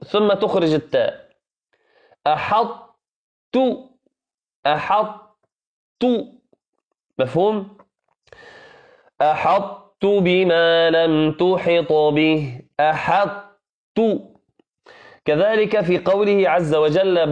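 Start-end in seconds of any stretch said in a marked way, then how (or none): 2.3-6.0 "أحط" drawn out very slowly, heavy ط, then a t; heavy emphatic ط. Shown as a demonstration. Teacher drilling students slowly on the emphatic ط. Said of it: "هنا تملأ الفم بالإطباق". 9.2-14.0 "أحط بما لم تحط به" very slow with full heavy ط; slow model recitation. Shown as a demonstration. Teacher reciting the verse slowly as a model. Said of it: "تملأ الفم بالإطباق".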